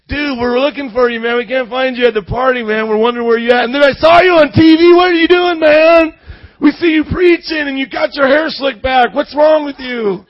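loud, high mocking party friend; ribbing him like an old party pal; party buddy. Old friends calling after seeing him on TV, loud and mocking.

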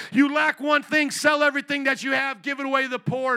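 Jesus tells the rich man, loud; loud preaching Jesus voice. Retold or imitated. Jesus' demand preached loudly.

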